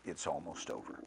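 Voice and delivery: grieving softly that it is ending; quiet resignation. Shown as a demonstration. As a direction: let it fall, sad it's ending. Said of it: "the other version of".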